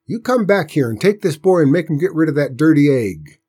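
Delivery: high, loud scolding order; loud, exasperated country aunt. A narrator's reading. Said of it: "she raised her voice".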